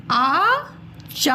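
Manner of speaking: आचार्य stretched slowly, half र before य clear; reading आचार्य aloud to children; slow model word. Shown as a demonstration. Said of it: "य के ऊपर ये रेफ रूप लगा है".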